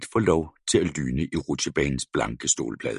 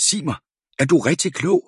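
exasperated scolding; 9 semitones higher than usual. A narrator's reading. Breathless mother scolds.

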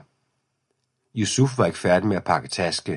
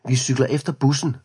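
higher call across the street; 6 semitones higher than usual. A narrator's reading. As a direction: call it after him from the bike. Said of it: "råbte Camille til Yusuf". (råbe efter ham fra cyklen)

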